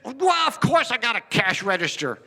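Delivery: high, indignant retort; imitating an affronted storekeeper; mildly insulted. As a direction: answer as if the question insults you. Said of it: "Little insult".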